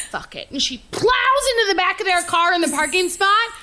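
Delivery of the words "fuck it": low, hushed Fuck it under the breath; muttered fuck it. Retold or imitated. Kathy Bates gives up and mutters. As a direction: mutter it darkly to yourself. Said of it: "and she says".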